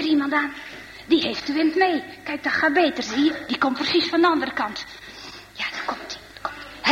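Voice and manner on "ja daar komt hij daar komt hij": breathless whisper, voice nearly gone; hushed whisper. Acted. Boys hiding behind the pile whisper as a cyclist nears. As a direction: whisper so the cyclist can't hear.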